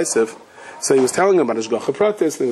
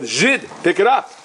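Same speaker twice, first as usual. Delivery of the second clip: higher, slow imitated command; retold Cossack order; 4 semitones higher than usual. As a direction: order him like a yelling Cossack, without real volume. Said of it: "this Cossack is yelling at him".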